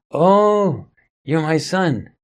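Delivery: retold surprised realization. Recognising his son in meditation.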